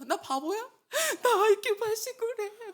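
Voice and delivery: high, loud imitated wail; mock crying. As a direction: wail it like a hammed-up crybaby. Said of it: "근데 막 울었잖아".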